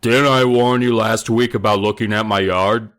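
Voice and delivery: loud menacing bully demand; bully warns kids off his yard. A narrator's reading. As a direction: threaten them, pushing every word. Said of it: "Conan demanded".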